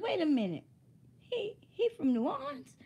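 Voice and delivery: high-pitched, incredulous double take; comic astonished questioning; retold surprise. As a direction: pitch jumps up in disbelief. Acting out surprise at Bentley's origin.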